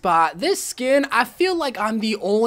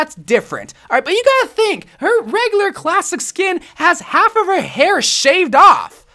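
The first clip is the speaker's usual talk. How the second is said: usual speaking voice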